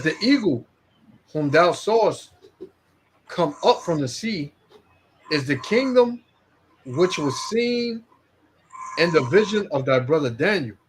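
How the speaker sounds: slow, measured reading of scripture. Acted. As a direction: read it out like holy text. Reads scripture aloud.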